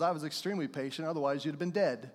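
fast dry retort; fast teasing reply; quick deadpan joke. Retold or imitated. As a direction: rattle it off deadpan. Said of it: "my response back was".

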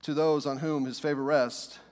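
scripture reading. Acted. Pastor reading the shepherds passage to church, measured and low. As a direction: read the passage to the congregation. Reads the Bible passage aloud.

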